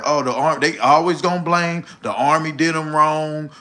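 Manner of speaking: ordinary delivery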